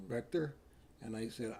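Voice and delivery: ordinary delivery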